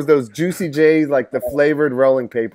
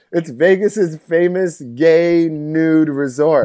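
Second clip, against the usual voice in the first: slow, drawn-out mock reveal; much slower than usual. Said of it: "He's like".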